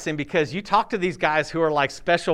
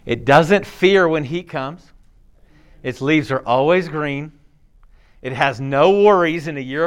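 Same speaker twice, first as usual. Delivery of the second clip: lower, measured Bible reading; scripture reading; 3 semitones lower than usual. Acted. Pastor reading the verse to the congregation, measured reading.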